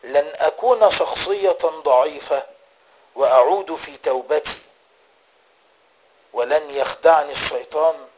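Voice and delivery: preaching with firm, driving emphasis; forceful exhortation. Real, not acted. Preacher urges listeners to resolve on repentance, forceful exhortation.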